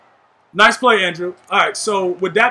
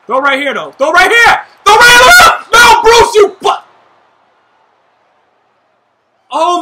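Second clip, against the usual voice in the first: angry yelling; 7 semitones higher, 6 dB louder than usual. Real, not acted. Furious at teammate Bruce's mistake.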